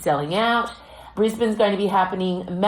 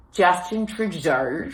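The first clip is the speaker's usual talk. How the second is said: usual speaking voice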